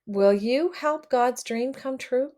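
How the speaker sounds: ordinary delivery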